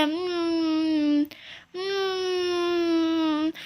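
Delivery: long drawn-out "mmm" on a level pitch; savouring hum. Acted. Girl shows off her yummy candy, savouring hum.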